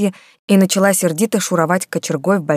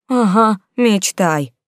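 ordinary delivery

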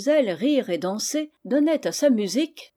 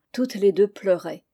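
ordinary delivery, nothing marked

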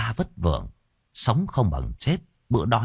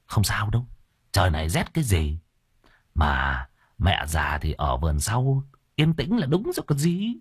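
dismissive brother-in-law; 2 semitones lower than usual. Acted. Brother shrugs off mother's plight.

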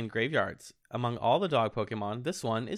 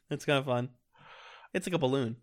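ordinary talk

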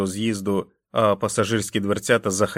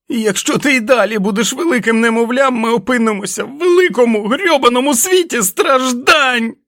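furious shouting; 9 dB louder than usual. Acted. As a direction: scream at him with each blow. Jack beating and yelling at wolf. (несамовитий лютий крик)

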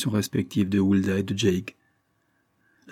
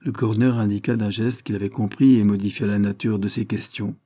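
plain reading voice

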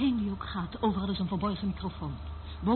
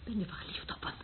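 teasing whisper; 8 dB quieter than usual. Acted. Teasing, hushed question.

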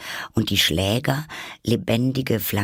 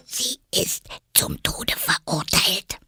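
slow, secretive whisper; 10 semitones higher, slower than usual. A narrator's reading. Rabbit confiding in Alice's ear, whispering. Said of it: "dicht an Alice Ohr und wisperte".

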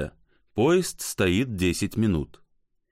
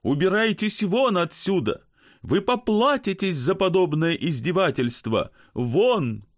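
indignant; 9 semitones higher than usual. Acted. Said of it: "возмущается публика".

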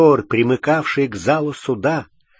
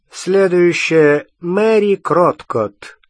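slow, higher, louder summons; Umbridge calling out; 5 semitones higher, 5 dB louder, slower than usual. Acted. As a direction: call the next name out slowly. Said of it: "произнесла Амбридж".

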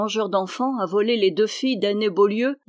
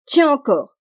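loud, higher shouts, one per blow; crying out; 6 semitones higher, 9 dB louder than usual. A narrator's reading. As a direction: shout each word like a strike. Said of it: "s'écria-t-il".

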